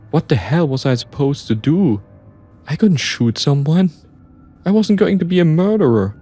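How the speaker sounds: high-pitched, desperate defiance; frantic outburst. Acted. Narrator refusing to be a murderer, frantic. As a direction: curse it all in mounting panic.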